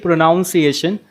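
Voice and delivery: pronunciation with NOUN as second syllable; wrong pronunciation. Shown as a demonstration. Teacher quotes what some people say, mispronounced pronunciation. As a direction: say pronunciation as pro-NOUN-see-ation. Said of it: "but it is incorrect".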